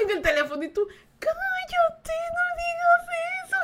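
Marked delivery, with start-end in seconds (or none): 1.2-3.6 put-on embarrassed little girl. Girl hushing family.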